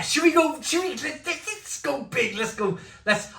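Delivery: high, excited false starts. Real, not acted. Beer reviewer daring himself to go big, excited. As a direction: egg yourself on, voice shooting up high.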